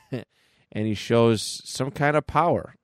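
usual speaking voice

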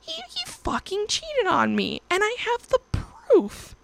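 real distress rising to a high squeak; upset outburst. Real, not acted. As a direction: voice jumps high, pitch straining with upset. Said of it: "No, I can't fucking work this shit out".

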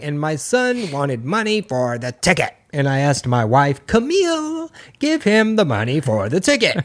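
mock-solemn elder, stretching words and swooping up; Bill Cosby. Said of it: "he'd be like".

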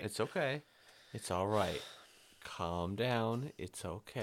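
mock soothing. Mock-soothing angry friend.

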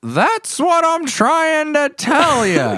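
put-on very high, loud, slow cranky old man; Grandpa Bob impression. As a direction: drag it out like a grumpy grandpa. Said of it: "your impression".

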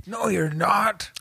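high, slow, drawn-out mock retort; mimicked angry retort. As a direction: mimic the neighbor, stretching each word up high. Mimics the neighbor's yelled retort.

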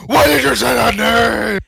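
loud, high mock-anguished scream. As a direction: scream the question as an outraged superhero. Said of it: "he's just screaming".

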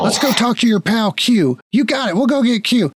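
high-pitched conspiratorial griping; agitated guard. Acted. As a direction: grumble to a comrade in a high, worked-up voice. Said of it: "you hear one of them go".

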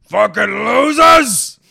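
loud, slow, grumbling dad shout; dad's grumble. Retold or imitated. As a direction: roar it out like his angry dad. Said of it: "my dad would say. He'd be like".

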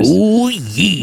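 high, strained wrestler catchphrase; Randy Savage. Acted. Wrestler interrupting the podcast recording, in Randy Savage voice. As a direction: burst in with a wrestler impression. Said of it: "Randy Savage".

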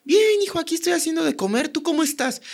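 mom's cheerful high reply; imitating his mom. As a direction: sing it out like a busy mom cooking. Mom answering on phone.

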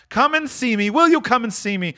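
high, pressing invitation; Scrooge, eager. Acted.

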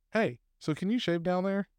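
usual speaking voice

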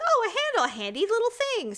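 high, chirpy naive voice; mock naive self. At Costco, mocking her own naivety in a high chirpy voice.

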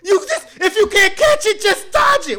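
high, loud laughing yell; laughing panic yell. Pretend. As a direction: shriek through laughter at a hopeless teammate. Panicking at teammate in Squid Games.

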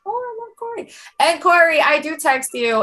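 mock an admirer in a tiny high voice; mimicked fan. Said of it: "someone was like".

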